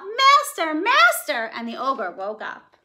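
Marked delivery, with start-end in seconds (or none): 0.0-1.5 very high, loud alarmed cry; high alarmed harp cry; calling out in alarm. Acted. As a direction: shriek for the master. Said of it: "the harp called out".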